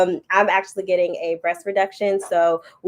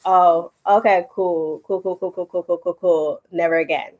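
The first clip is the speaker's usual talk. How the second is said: deflated. Retold or imitated. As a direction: lose all your confidence. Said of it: "you just be like".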